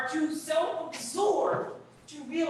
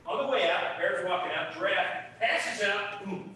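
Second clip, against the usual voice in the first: ordinary talk, nothing marked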